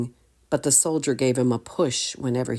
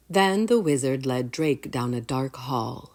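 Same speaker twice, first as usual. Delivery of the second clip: usual speaking voice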